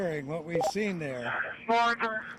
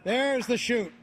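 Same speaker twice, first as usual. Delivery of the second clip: excited; 5 semitones higher, 4 dB louder than usual. Real, not acted. Commentator sees parachute open.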